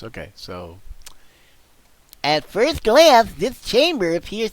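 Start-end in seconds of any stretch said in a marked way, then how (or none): 2.2-4.5 high, loud, slow cartoon voice. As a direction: ham it up like a toon. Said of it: "venturing a little bit into Roger Rabbit".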